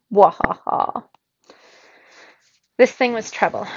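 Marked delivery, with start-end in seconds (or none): 0.1-1.0 loud, drawn-out villainous laugh; gloating mock laugh. A narrator's reading. Sweeper gloating at the baffled kid, mock laughing. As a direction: crow with a put-on laugh.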